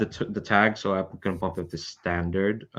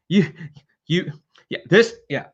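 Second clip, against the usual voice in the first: frazzled; 7 semitones higher than usual. Acted. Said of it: "he's frazzled in a way".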